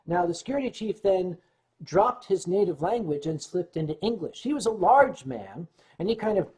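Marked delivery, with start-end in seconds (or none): none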